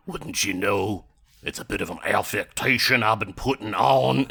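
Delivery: put-on smooth posh colonial accent; silky elocution. Recounting Gaius Baltar's voice change, silky elocution. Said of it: "his silky elocution".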